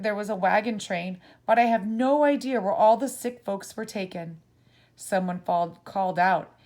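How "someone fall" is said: plain reading voice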